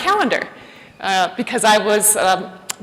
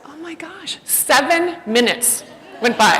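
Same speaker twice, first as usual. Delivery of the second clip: ordinary delivery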